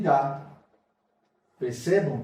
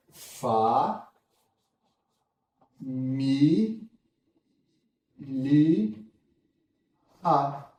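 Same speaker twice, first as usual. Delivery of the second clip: Fa, mi, li, a with long pauses between; syllable by syllable; much slower than usual. Shown as a demonstration. Teacher spells out família for students syllable by syllable. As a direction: sound out família one syllable at a time. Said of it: "syllable split".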